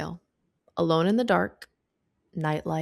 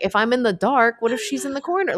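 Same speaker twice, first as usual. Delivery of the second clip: high, louder what-if fretting; mock worried; 7 semitones higher, 5 dB louder than usual. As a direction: play up a spooked thought, pitched high. Imagining the ghost in the dark.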